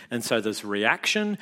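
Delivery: ordinary talk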